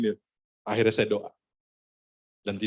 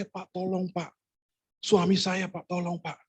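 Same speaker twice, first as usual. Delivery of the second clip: tearful plea; 7 semitones higher than usual. Retold or imitated. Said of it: "menangis-nangis lah suami, eh, istrinya".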